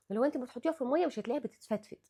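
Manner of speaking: usual speaking voice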